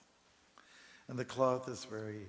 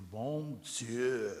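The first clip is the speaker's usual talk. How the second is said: put-on drawn-out French accent; French exclamation; 4 dB louder than usual. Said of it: "the French will say".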